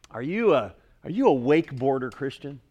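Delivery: ordinary delivery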